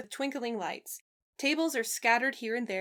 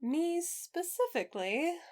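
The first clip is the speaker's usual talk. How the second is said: curious; 7 semitones higher, slower than usual. A narrator's reading. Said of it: "Roge asks curiously".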